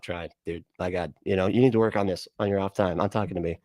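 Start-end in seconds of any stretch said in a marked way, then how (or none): none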